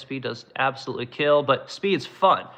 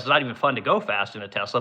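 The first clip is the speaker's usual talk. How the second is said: ordinary talk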